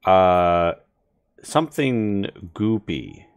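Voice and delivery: slow, hushed creepy scene setting; ominous narration. Acted. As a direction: draw out the dread, slowly. GM describes creeping goop.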